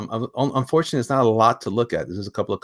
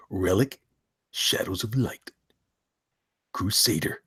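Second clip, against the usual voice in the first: hushed, deliberate title read; measured words with pauses between; Boyette impression; 3 semitones lower, 6 dB quieter, slower than usual. Host redoing the tagline in a friend's voice, hushed impression. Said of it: "let me read it in Boyette's voice".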